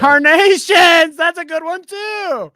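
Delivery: high, loud yelling "Carnations!" with a laugh in it; delighted shout. Real, not acted. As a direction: roar with delight at the joke. Delighted yell at friend's joke.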